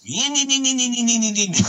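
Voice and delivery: nasal engine whine imitation; dirt bike buzz. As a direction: mouth noise of a revving dirt bike, high.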